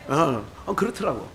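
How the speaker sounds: ordinary talk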